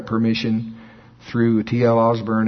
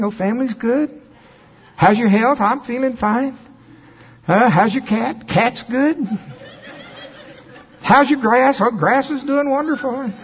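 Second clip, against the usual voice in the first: higher, breezy voice of the donor answering; cheerful donor voice; 11 semitones higher than usual. Retold or imitated. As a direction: play the calm donor, unbothered, with a lift.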